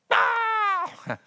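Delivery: loud, very high mock wail; sudden high comic scream; imitated daughter's scream. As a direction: shriek it out, hammed-up. Daughter's cry at the news.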